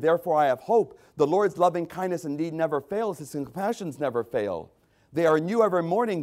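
ordinary delivery

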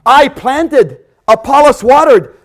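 loud, high proclaiming; thundering pulpit reading; preaching at full force. Acted. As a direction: boom the verse out to the hall.

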